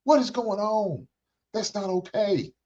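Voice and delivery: high, chiding sister stepping in; sister scolding a girl. Pretend. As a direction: play a sister calling a girl out for hitting a man. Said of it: "I don't see none of you sisters saying".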